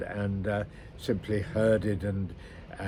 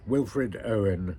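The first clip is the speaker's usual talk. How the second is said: usual speaking voice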